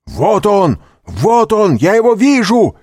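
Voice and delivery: очень высокий громкий крик; крикни тревогу во весь голос. A narrator's reading. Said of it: "крикнул голос за дверью". (громкий крик)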